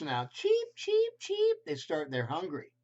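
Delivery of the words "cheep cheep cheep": very high chirpy peeps; baby chick cheeps. Acted. Hungry baby birds calling for food, high cheeps. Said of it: "calling for food".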